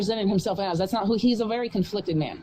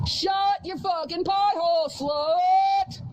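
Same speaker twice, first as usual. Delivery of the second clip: furious yell; 7 semitones higher, much slower than usual. Real, not acted. Streamer yelling out at the neighbor's barking dog, furious shout.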